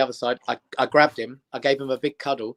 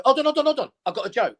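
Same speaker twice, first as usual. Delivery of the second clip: high, rushed hold on; hurriedly stalling the bullies, pitched up; mock plea to bullies; 11 semitones higher, faster than usual. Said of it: "I'd go".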